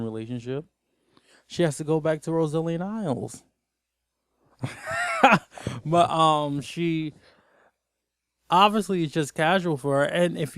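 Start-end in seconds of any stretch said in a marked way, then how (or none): 4.6-5.8 sudden high laugh; high burst of laughter. Real, not acted. Amused at her own joke, a sudden high laugh. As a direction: laugh out loud.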